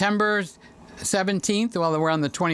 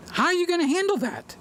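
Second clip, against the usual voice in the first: high, fast incredulous question; shocked; 12 semitones higher, faster than usual. Real, not acted. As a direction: react in disbelief at the news.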